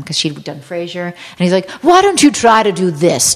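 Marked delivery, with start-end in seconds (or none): 1.8-3.3 mock director's instruction, slightly raised; pompous director. Kelsey instructing an actress on set, mock pompous. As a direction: hand down a note with showy authority.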